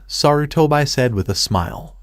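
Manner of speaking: ordinary delivery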